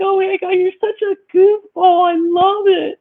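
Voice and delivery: high squeal imitating a delighted date; cry-laughing. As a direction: gush through mock tearful laughter. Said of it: "like, cry laughing".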